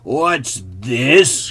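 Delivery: loud, great big bear voice; growling father bear. A narrator's reading. Father bear discovers his porridge disturbed, growling loudly. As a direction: boom it out with a growl. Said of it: "growled the father bear in his great big voice".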